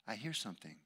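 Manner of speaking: very soft, low murmur; quiet, attentive aside; hushed listening. Acted. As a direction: say it softly, as if straining to hear. Acting Jesus stopping to listen.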